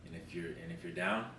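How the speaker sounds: soft, low mock boss; quiet boss voice. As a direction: lightly voice the boss, softly. Boss voiced in retold call.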